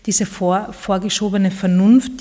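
normal talking voice

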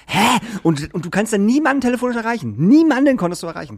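high, loud incredulous outburst; exasperated disbelief. Retold or imitated. Customer vents about closed, unreachable head office, exasperated disbelief. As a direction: raise pitch and volume in baffled outrage. Outraged at company being unreachable.

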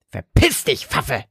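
spiteful spit. Acted. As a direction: spit the insult out hatefully. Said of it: "spie sie dem Geistlichen gehässig entgegen".

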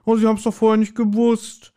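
hammed-up sobbing, voice way up. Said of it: "Tanja begann wieder zu heulen".

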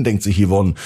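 plain reading voice

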